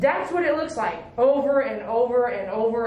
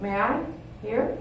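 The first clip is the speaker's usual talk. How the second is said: ordinary talk, nothing marked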